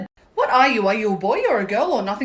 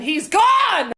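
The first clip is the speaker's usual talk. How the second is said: triumphant shout; 6 semitones higher, 4 dB louder than usual. Acted. Comeback punchline to Karen.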